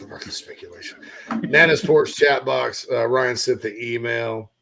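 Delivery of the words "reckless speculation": whispered aside. Real, not acted. Repeats co-host's joke in a whisper.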